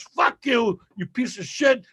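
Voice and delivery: high-pitched mock fury; angry parting curse. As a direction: imitate an outraged guy cursing someone off. Retelling guy's parting curse.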